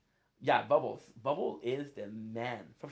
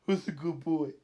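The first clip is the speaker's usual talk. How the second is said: pet talk; 2 semitones higher, 6 dB louder than usual. Pretend. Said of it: "I'll be like".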